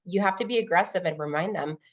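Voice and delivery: normal talking voice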